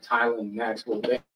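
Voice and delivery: name said slowly, word by word; slow it right down for the listener; deliberate, drawn-out repeat of the name. Retold or imitated. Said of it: "I slowed it up".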